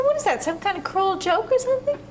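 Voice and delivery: high-pitched, offended challenge; hurt indignation, pitched well up; indignant protest. Acted. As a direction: demand an explanation, stung. Patient angered by therapist's order.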